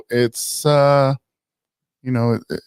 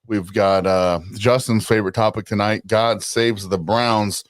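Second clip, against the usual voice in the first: normal talking voice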